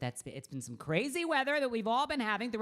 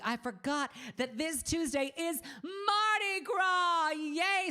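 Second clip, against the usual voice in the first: excited; 9 semitones higher, slower than usual. Real, not acted. Mardi Gras excitement announcement.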